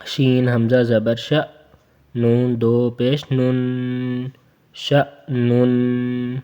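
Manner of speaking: slow, drawn-out شأنن; slow recitation demo; spelling drill. Shown as a demonstration. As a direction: sound it out slowly. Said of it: "ہمزہ کے اوپر رکتے ہیں".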